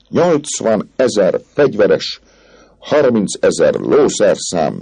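the captain listing the parade slowly and grandly. A narrator's reading. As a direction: stretch out each number slowly.

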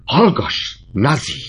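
sharp, high, loud shout; kiáltás. A narrator's reading. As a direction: shout her down at once. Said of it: "Kiáltott rá az apja". (apa rákiált lányára, élesen, hangosan)

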